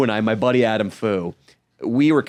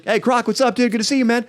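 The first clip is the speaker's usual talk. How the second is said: much higher, breezy greeting; imitating himself; 11 semitones higher, faster than usual. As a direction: call out to Croc high and casual. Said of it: "And then".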